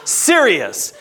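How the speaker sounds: usual speaking voice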